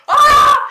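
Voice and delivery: short, very loud, high yelp; hammed-up frightened scream; startled scream. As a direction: shriek as if ducking an attack. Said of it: "I was like".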